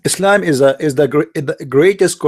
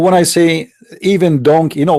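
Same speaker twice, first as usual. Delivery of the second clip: normal talking voice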